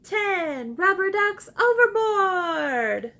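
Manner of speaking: loud, high and slow excited call; excitedly. Shown as a demonstration. Teacher leading the class excitedly. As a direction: shout it out with big excitement. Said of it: "Say it excitedly with me".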